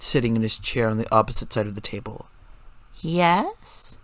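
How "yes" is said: mock-innocent bright yes; higher, faux-sweet reply; sarcastically innocent. A narrator's reading. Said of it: "sarcastically innocent response".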